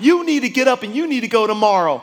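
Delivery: high, loud, gushing; excited wife. Retold or imitated. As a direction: rave about it, can't hold it in. Wife raving about conference.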